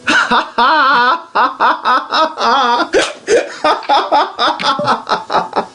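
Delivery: high, breathless, rolling laugh; can't stop laughing; long giddy laugh. Real, not acted. Reaction continues.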